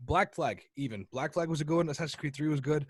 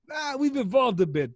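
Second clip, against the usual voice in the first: smug uncle voice; 5 semitones higher than usual. Retold or imitated. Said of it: "He's like".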